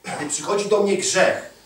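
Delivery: high, loud sermon climb; impassioned preacher at the pulpit; preaching at full force. Acted. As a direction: build up into a loud, fired-up preach.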